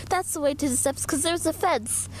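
ordinary delivery

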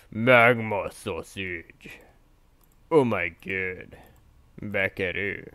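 slow, drawn-out trailer-voice naming; dramatic announcer. Shown as a demonstration. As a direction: savor the name like a movie trailer.

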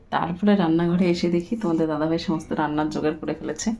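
ordinary talk

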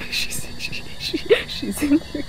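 too tickled to get the words out; breathless laughing. Real, not acted. Startled, laughing at ghost's arrival.

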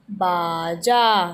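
बाजा stretched very slowly, both syllables held; slow drawn-out word. Shown as a demonstration. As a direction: draw out बाजा for the class.

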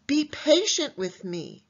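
high, pleading plea; a debtor on his knees pleading; begging. Acted. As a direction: beg the king for mercy. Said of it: "He begged".

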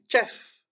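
Kaf said with a strong, insistent k; emphatic kaf. Shown as a demonstration. Teacher stresses the k in Kaf for students, insistent. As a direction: lean into the k of Kaf. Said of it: "on insiste plus".

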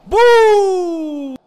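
high, loud, drawn-out whoop; long shouted "boo". Real, not acted. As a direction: let out a long falling "buuu". Cry during the attack.

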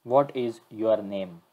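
slow, deliberate question to the assistant; slow clear command. Real, not acted. Asking the chatbot its name, slow and clear. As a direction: spell it out slowly for the machine.

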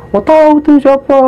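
very high-pitched tearful-style reply; imitated upset denial. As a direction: answer like a distressed girl, without real crying. Crying girl answers upset, retold. (menirukan jawaban merengek bernada tinggi)

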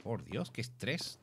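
ordinary talk, nothing marked

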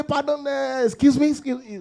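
mock puzzled listener. Imitates people feigning not to hear.

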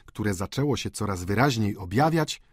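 plain reading voice

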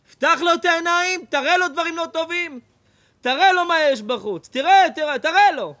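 high, loud, driving sermon voice; full-force rabbi's exhortation; fiery preaching. Acted. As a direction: preach it hard, almost shouting.

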